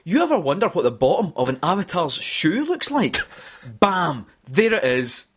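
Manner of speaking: showy stage presenter, lifted pitch; conference presenter voice. Acted. Podcast host reading a quiz quote, conference presenter voice. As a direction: pitch it like a keynote demo reveal. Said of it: "do this in my most, um, conference-like, um, voice".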